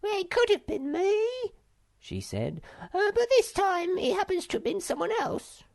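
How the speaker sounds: a silly monkey who can't count; Silk Monkey voice. A narrator's reading.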